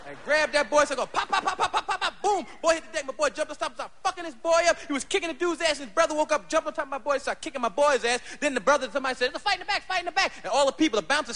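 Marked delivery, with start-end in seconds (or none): 0.8-2.5 rapid pop pop sound effects; imitated pops. Imitating gunshots/punches. 9.3-10.3 higher urgent call; club alarm call. Retold or imitated. Somebody shouting alarm.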